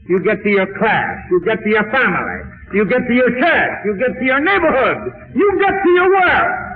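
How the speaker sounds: chanted list climbing steadily in pitch; urgent exhortation. Acted. Preacher sending listeners to class, church and work, urgent.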